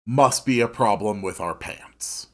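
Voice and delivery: ordinary delivery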